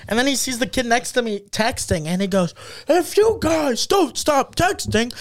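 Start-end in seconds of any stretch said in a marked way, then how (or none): 2.9-5.1 slow, high mock drunk threat; drunk teacher yelling. Drunk teacher warning texting students, mock yelling. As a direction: imitate a hammered teacher laying down the law. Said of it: "he's just drunk yelling at us".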